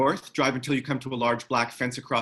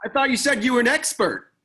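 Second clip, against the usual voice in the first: teasing; 12 semitones higher, 6 dB louder than usual. Acted. Teasing jab at Eden.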